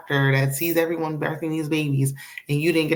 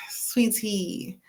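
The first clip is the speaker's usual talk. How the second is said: a hushed whispered aside to Sweet Tea; 2 semitones higher, 6 dB quieter than usual. Real, not acted. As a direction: lean in and whisper the name.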